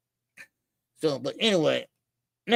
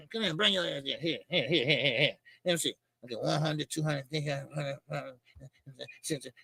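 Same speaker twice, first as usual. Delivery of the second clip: mock counting out cash; 6 semitones lower, 8 dB quieter than usual. Acting out paying Eddie off.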